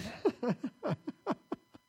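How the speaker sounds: rising laugh after the joke; short real laugh. Real, not acted. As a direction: laugh at your own story.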